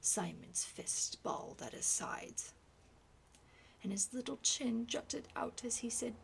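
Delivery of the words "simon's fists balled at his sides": ordinary talk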